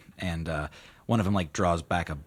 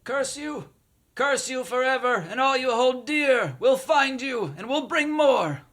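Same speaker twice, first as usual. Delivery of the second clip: shouting curse; 6 dB louder than usual. Acted. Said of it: "call back".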